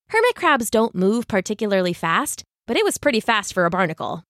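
plain reading voice

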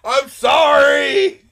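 huge high drawn-out mock shout; imitated yelling. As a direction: holler it at the radio, stretching every vowel. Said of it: "he was yelling at Mike at the radio".

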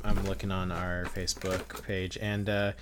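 usual speaking voice

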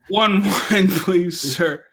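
laughing through a polite wine request; laughing mock order. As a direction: break into a laugh while ordering. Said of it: "not in that tone".

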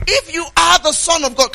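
high, loud, mocking challenge; devil's taunting challenge. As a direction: taunt him like the devil would. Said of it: "he said to him".

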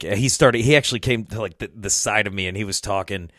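ordinary talk, nothing marked

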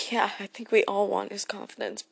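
ordinary talk

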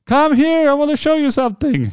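high, loud calling out; excited acted shout; screamed summons. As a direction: call someone over from the next room. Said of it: "he starts screaming".

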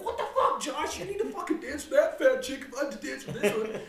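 high, loud mock yell at Josh, laughing. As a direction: yell across the dance floor half laughing.

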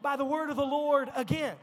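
loud, high preaching call. Acted. Preacher urging the congregation, full preaching voice. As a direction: lift the room, preach it out.

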